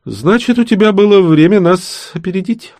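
high, loud retort; witch's challenge. A narrator's reading. Witch challenges rivals in story dialogue.

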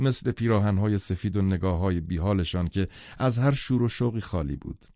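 usual speaking voice

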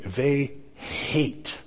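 hate set apart and drawn out; stress on hate. Real, not acted. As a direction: lean hard and long on the word hate. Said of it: "put enough emphasis on the word hate".

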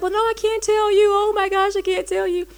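imitating a high-pitched tearful protest. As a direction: act out wailing that you can't confide. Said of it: "And I'm crying".

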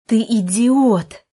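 indignant shout, stretched out and loud; angry outburst. Acted. Brother rages at his sister for meddling, angry.

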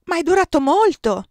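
high, loud cry from the window; calling out. A narrator's reading. Giulia calls from the window, a high loud cry. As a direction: call out to her from above. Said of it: "la voce di Giulia".

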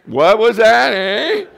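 mock deaf husband. Husband hamming up being deaf to his wife, high and loud. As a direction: bellow it like you can't hear a thing. Playing hard of hearing.

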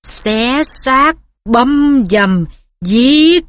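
icy hissing monster voice; cold bloodthirsty voice. A narrator's reading. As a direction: chant it like a hungry beast.

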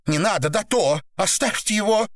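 high, thin plea without much volume; pleading cry. A narrator's reading. Woman begs men to stop torture, high pleading cry. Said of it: "кричала Наташа".

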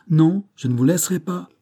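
insistent outcry; emphatic refusal. A narrator's reading. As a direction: refuse loudly, allowing no argument. Banks keeps forbidding them entry.

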